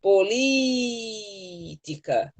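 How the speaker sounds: "Política" with LÍ stretched very long; stressed syllable held long. Shown as a demonstration. Teacher showing the strongest syllable, "Política" drawn out on LÍ. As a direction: hold the "lí" of "Política", then drop off "tica". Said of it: "qual a sílaba demora mais falando".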